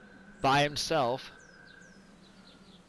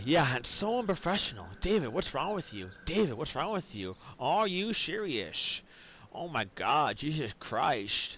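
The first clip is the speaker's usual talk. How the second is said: imitating the listener. Teasing David over being unprofessional, imitated exasperated scolding. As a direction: mimic a friend's scolding, stretching the last words. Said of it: "That's me trying to imitate you".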